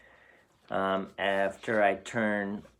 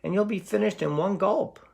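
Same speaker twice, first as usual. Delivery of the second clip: high, pleading little goat voice; trembling little goat; 10 semitones higher than usual. Acted. Little goat pleading with the troll, high and frightened. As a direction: beg the troll not to eat you, tiny and scared. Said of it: "trembled where he stood".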